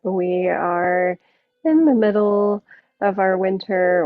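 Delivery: usual speaking voice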